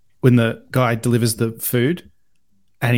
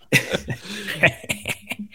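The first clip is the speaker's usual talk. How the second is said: higher, softer put-on laugh; Tom Cruise laugh; 6 semitones higher, 7 dB quieter than usual. Joking with co-hosts as a film star, imitation laugh. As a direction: imitate an actor's signature laugh. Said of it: "My Tom Cruise laugh and my Christopher Lambert laugh".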